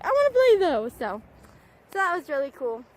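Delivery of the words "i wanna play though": puppy voice. Retold or imitated. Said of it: "he can be a little bit like".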